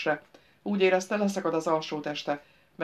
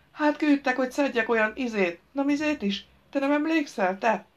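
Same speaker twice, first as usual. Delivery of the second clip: put-on high, childlike village fool; village simpleton, muddled; 8 semitones higher, 4 dB louder than usual. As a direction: prattle cluelessly, pitched well up.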